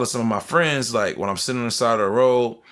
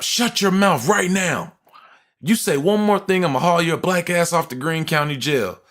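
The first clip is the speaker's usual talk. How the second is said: angry cop, retold; 6 semitones higher than usual. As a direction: imitate a cop ordering someone to shut up. Retold cop ordering him silent.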